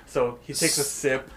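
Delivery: ordinary talk, nothing marked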